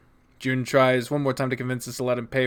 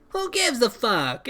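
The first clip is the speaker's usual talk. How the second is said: character catchphrase; 8 semitones higher, slower than usual. Acted. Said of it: "I can't do an Italian accent".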